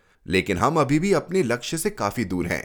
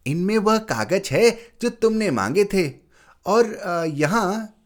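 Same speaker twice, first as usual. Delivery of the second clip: higher, hesitant aunt's voice; 9 semitones higher than usual. Acted. Aunt hesitantly offers papers, higher and halting. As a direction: hand it over haltingly as the aunt. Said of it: "बोली".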